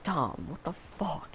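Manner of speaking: imitating mom's shocked cry, high and slow; mock high-pitched gasp of disbelief. Mom yelling at stepdad over the smashed bowl, high and shocked. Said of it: "My mom's just like".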